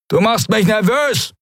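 a guard hopped up on drugs, barking orders; drugged-up pirate. Acted. Guard to captive, high jittery drug-fuelled jeer. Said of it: "seine Stimme von Silberfeuer ... aufgeputscht".

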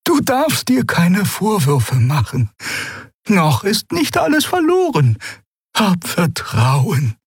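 put-on slow reassuring voice of an old creature; reassuring old woman. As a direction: soothe him, make him believe. Xea comforting Keru.

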